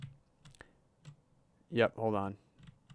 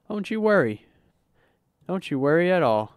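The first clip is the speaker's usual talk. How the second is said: chirpy waiter; 7 semitones higher, 6 dB louder than usual. Acted. Reassuring customers as waiter.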